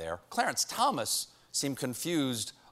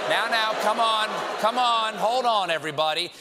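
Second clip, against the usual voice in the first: calling out; 6 semitones higher, 4 dB louder than usual. Real, not acted. Calming the laughing audience.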